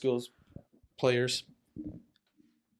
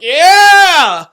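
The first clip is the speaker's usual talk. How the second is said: mock cheer; 11 semitones higher, 13 dB louder, much slower than usual. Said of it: "and you're like".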